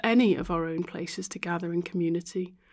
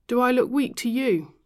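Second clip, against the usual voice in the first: raised, defiant challenge; defiant retort; 5 semitones higher, 7 dB louder than usual. A narrator's reading. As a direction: throw the question back sharply. Ruth bristling at help.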